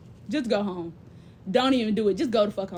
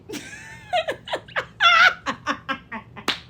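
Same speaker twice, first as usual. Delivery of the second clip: high, long cackling laugh. Real, not acted. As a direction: crack up at your own joke. Loud laugh after joke.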